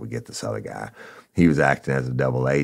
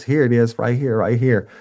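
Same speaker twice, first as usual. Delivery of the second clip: higher, louder, hurried handover; eager scared informant; 10 semitones higher, 6 dB louder than usual. Retold or imitated. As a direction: squeak it out like a caught snitch. Scared informant handing over meth.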